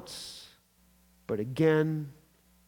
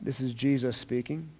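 ordinary delivery

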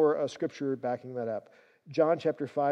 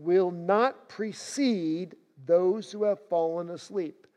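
slow, weighty reading of the verse; scripture reading; 3 dB louder than usual. Acted. Preacher reading the verse to his congregation, solemn. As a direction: read the promise slowly and solemnly. Scripture read aloud in sermon.

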